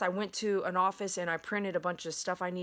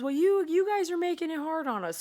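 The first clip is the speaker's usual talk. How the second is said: David's protest quoted louder and much higher; David's complaint; 10 semitones higher, 7 dB louder than usual. Retold or imitated. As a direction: voice David pushing back. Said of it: "And he goes".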